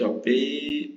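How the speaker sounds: ordinary talk, nothing marked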